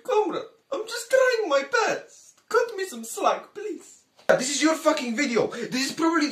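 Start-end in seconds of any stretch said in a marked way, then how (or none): 0.0-4.0 much higher, slow, pleading; whiny Libya. Acted. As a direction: whimper the plea up high and drawn out. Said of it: "Libya, you are kind of, of a shithole".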